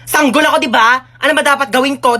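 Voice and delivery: ordinary talk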